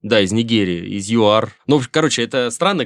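ordinary delivery

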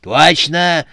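loud, high cantankerous agreement; quarrelsome. A narrator's reading. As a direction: agree like a squabbling granny. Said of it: "сварливо отозвалась Матрёна Фёдоровна".

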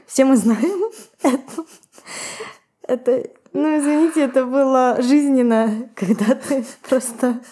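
real laughter breaking through the words; laughing. Real, not acted. Host cracks up at a painfully relatable line, laughing. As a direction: struggle to talk through the giggles.

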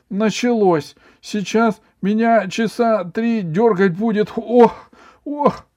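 high, loud wailing schoolboy; hammed-up boyish wail with yelps; feigned fit. A narrator's reading. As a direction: announce the attack in panicky high cries. Ivan fakes a twitching fit.

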